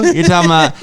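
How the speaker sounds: ordinary talk, nothing marked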